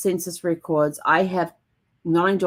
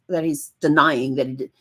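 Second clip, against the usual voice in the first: normal talking voice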